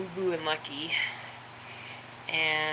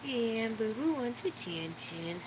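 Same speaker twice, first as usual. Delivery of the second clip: soft slow coo; 2 semitones higher, 6 dB quieter than usual. Real, not acted. Cooing to dog.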